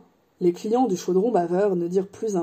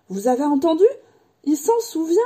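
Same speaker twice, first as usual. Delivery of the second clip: high, excited announcement to everyone; thrilled exclamation; 8 semitones higher than usual. A narrator's reading. Diggle telling everyone around him, thrilled exclamation. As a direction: share your amazed joy with the room. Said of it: "s'écria Diggle en le regardant tout le monde".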